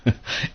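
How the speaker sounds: a soft embarrassed chuckle; bashful laugh. Real, not acted. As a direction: laugh lightly, a little shy at the praise. Flattered by the introduction.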